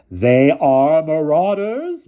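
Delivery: put-on high, slow, shrill old lady; prim old headmistress, high and drawn out. A narrator's reading. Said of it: "Miss Scrimmage accused".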